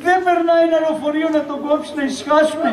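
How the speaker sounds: high mock-lament turned curse; wailed curse. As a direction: keep wailing while wishing him gone. Wife turns lament into curse. (κατάρα σε ύφος μοιρολογιού)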